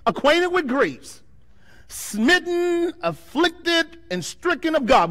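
preached reading of Isaiah; proclaimed scripture. Acted. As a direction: intone the verse with gravity. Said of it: "The scripture says".

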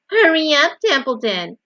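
loud, high mimicry dropping at the name; mocking imitation. A narrator's reading. Rat imitates Wilbur's voice, mocking him. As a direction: parrot the plea with scorn. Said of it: "imitating Wilbur's voice".

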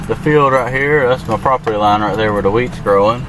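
ordinary delivery

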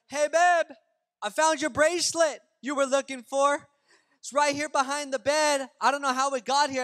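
re-enacted holler, high and loud; yelling out. Husband telling his wife he found her bracelet, yelling out. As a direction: call loudly to someone in another room. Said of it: "I yelled out to her".

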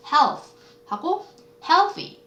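"health" ending on th, "healthy" ending on an added ee; contrasted word endings. Shown as a demonstration. Teacher showing noun versus adjective, "health" and "healthy" slowly contrasted. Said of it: "이 차이예요".

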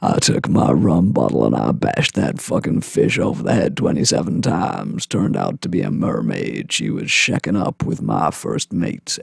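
low, soft sea-dog yarn; old fisherman. Acted. Old fisherman tells of his deadly fight, deep storytelling. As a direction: recount it gravely in a deep old seaman's voice. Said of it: "old fishermen's tales".

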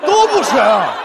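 loud comic refusal; loud, fed-up retort; exasperated refusal. Acted. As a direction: refuse both choices, loudly. Rejects partner's silly choice.

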